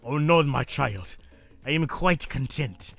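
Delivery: put-on hammy Russian mother accent; butchered Russian accent. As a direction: play an old mama with bad Russian accent. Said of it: "I'm sorry if I'm butchering this Russian accent".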